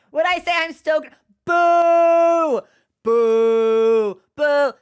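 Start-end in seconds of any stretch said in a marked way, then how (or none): 1.5-4.7 loud mock booing of a bad pun; playful boo. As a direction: boo yourself, hammed-up and loud.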